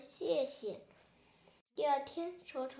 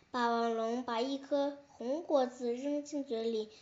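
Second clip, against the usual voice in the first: ordinary delivery, nothing marked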